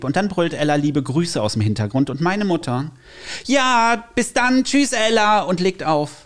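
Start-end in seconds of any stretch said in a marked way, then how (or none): 3.4-5.4 high, loud phone goodbye, hammed up; imitated cheery mother. Son imitates his mother signing off to Ella, loud and high. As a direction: call out a breezy goodbye as mum.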